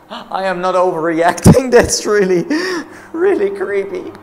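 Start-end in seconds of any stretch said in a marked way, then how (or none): none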